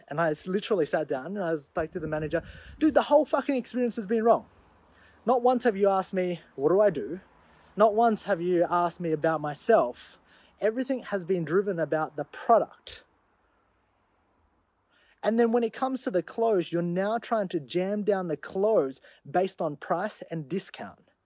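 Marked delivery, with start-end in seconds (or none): 2.8-4.5 higher, louder angry outburst at the manager; angry complaint. Retold or imitated. Customer venting to the dealership manager, angry and loud. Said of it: "I got angry". 5.3-21.1 louder heated scolding, slowing on key words; angry rant. Retold or imitated. Customer scolding the manager, loud and heated. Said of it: "I got angry".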